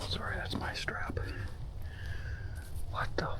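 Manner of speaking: hushed whisper. Real, not acted. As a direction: breathe it out so nothing hears you. Sneaking through the woods at night.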